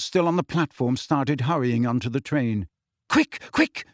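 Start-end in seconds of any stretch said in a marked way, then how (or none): 3.1-3.9 high, hurried fussing mum; rushing everyone along; distracted mother. A narrator's reading. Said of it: "said Missus Weasley distractedly".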